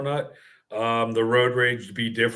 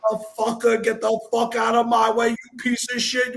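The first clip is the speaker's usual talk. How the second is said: retold road rage yelling, pitched way up; road rage screaming; 11 semitones higher than usual. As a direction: scream it at the car that cut you off. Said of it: "I'm screaming every Italian fucking curse word".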